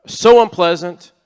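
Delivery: slow, loud, disgusted groan. Retold or imitated. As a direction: drag it out in disgust. Acted complaint in quoted prayer.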